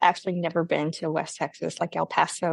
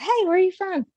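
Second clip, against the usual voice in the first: put-on much higher kid's question; curious kid; 12 semitones higher than usual. Kid approaching husband at breakfast bar, high-pitched. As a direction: pipe up like a kid. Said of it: "A kid approached my husband".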